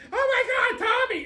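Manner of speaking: very high, gushing squeal of recognition; mock excited fan. Bar regulars spotting Tommy, mock overexcited. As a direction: mimic overexcited fans like dogs wagging tails. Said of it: "they get all excited like dogs".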